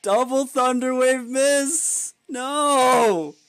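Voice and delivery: loud, high, drawn-out wail; stretched yell of despair; anguished disbelief. Real, not acted. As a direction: cry out slowly at rotten luck. Reacting to a double miss.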